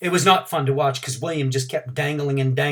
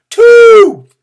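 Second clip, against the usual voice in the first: number shouted much higher and louder; shouted count; 19 dB louder than usual. A narrator's reading. Teacher counting Frankie's pull-ups, shouting. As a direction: shout it across the yard. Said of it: "shouted roommate".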